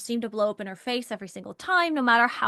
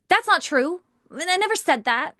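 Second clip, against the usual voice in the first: higher, louder mock denial; acted defensive retort; 6 semitones higher, 4 dB louder than usual. Coach demonstrates jumping in on a partner's complaint, defensive. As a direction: jump in to deny it, pitch raised. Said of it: "Instead of jumping in with".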